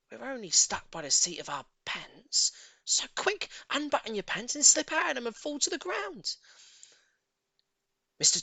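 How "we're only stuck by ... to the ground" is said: put-on urgent boy. A narrator's reading. Boy urges escape.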